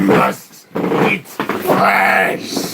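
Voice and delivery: rasping undead groan, hungry for flesh; zombie. Acted. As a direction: growl it like a starving zombie. Zombie voice in horror game.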